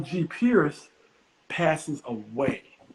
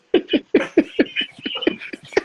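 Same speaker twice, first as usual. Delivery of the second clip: squealing laugh; real laugh at his own story; high breathy laugh; 5 dB louder than usual. Real, not acted.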